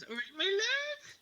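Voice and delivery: flirt cheekily, pitched well up; saucy. Acted. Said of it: "a saucy wink and goes".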